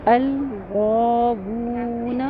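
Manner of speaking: الغاوون with both madds held long and slow; elongated recitation. Shown as a demonstration. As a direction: stretch each long vowel. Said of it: "الف مدہ غا میں اور واو میں". (دونوں مد کھینچ کر)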